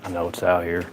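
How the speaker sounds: out of breath, panting between words; winded and catching breath; after a workout. Real, not acted. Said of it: "Sorry, I'm just catching my breath".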